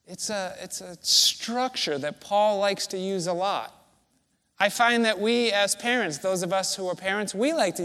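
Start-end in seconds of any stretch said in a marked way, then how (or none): none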